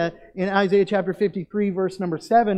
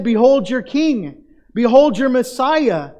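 loud, higher sarcastic announcement; mock proclamation; 5 semitones higher, 7 dB louder than usual. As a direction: hail him as king, mocking. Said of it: "Giving him this title".

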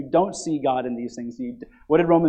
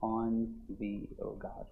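reverent quote; 4 semitones lower, 10 dB quieter, slower than usual. Retold or imitated. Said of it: "David said".

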